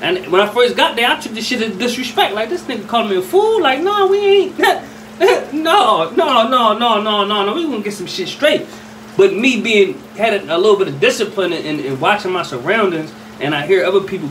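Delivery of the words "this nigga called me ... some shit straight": offended reaction. Retold or imitated. Taking the greeting as disrespect.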